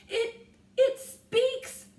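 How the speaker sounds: very loud, high, slow shout; astonished shout. A narrator's reading. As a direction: shout in awe. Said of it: "shouted a sailor".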